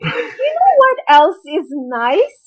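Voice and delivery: slow, insinuating aunt voice; sly aunt. Retold or imitated. Aunt hinting about the young man, slow and sly. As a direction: draw it out slyly. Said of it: "she's like".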